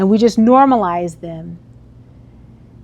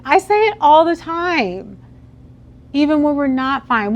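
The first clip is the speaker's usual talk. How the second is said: ordinary talk, nothing marked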